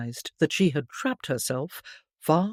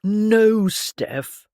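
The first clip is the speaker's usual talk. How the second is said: plain reading voice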